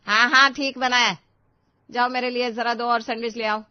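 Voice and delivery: grudging mother-in-law. Acted. Mother-in-law grudgingly wants more.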